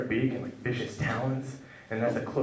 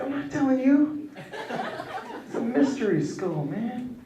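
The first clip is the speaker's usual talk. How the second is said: mock secretive; 14 semitones higher than usual. His own cocky quoted reply.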